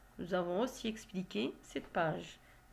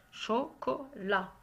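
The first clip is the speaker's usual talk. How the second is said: CHOCOLAT slowly split as cho, co, la; slow "chocolat" with a small gap after each syllable; 3 semitones higher, slower than usual. Shown as a demonstration. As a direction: say "chocolat" in three separate beats for pupils. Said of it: "c'est dans la dernière syllabe".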